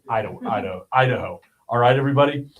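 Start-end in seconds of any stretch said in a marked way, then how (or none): 0.1-1.4 repeating Idaho with a laugh to fix the slip; amused self-correction. Real, not acted. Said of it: "God, I got him".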